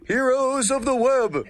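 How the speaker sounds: big announcer voice. Pretend. Host announcing the show's segment to listeners in a slow dramatic call. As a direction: boom out the segment name like a trailer.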